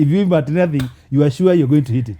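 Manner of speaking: loud laughing punchline. Real, not acted. Saying retold, laughing and loud.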